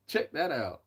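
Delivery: real amused laugh spilling into the words. Real, not acted. Laughing at a viewer's joke.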